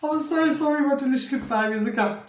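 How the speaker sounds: apologizing while fighting back laughter; giggly, high apology; laughing apology. Real, not acted. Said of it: "I have to keep a straight face".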